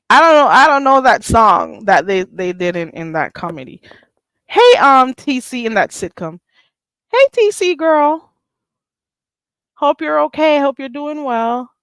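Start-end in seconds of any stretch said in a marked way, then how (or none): 4.5-11.7 high, loud hello to a friend; excited greeting. Real, not acted. Greeting T C girl in chat, bright and high.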